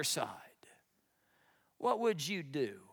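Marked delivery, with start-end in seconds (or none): none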